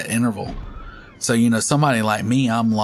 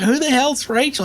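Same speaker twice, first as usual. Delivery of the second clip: annoyed clerk; 4 dB louder than usual. Retold or imitated.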